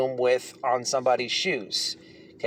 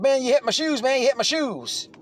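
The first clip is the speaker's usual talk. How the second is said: mock indignant complaint, higher voice; mimicking the upset inmate; 9 semitones higher than usual. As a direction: voice pitched higher, insisting it over and over. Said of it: "He's like".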